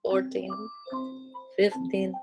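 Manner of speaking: ordinary delivery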